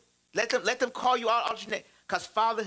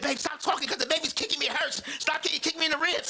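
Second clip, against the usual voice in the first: lightly voiced pregnant wife, higher and pleading; wife's complaint; 9 semitones higher than usual. Wife telling husband the kicking hurts, mildly raised pleading. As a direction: play the wife begging him to stop talking to the womb. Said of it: "She's like".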